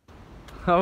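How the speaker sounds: sudden burst of breathy laughter; loud laugh. Real, not acted. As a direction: break out laughing. Bursts out laughing before greeting.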